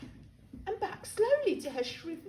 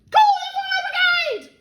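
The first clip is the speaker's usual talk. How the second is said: much higher, louder panicked shout; 11 dB louder than usual. Acted. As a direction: bellow for help. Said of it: "she shouted suddenly".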